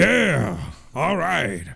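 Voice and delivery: high, loud gung-ho growl; Al Pacino. Acted. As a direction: bark it like a fired-up colonel. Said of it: "Al Pacino's a little bit more up here".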